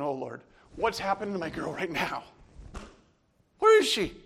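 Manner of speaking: rising to a frantic high yell at the end; a father crying out for his lost girl, panicked; panicked cry to God. Retold or imitated. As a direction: pray aloud in growing panic, ending in a cry.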